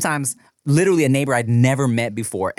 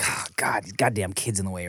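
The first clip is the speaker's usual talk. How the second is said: annoyed grumble; 6 semitones lower, 8 dB quieter than usual. Retold or imitated. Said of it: "be like".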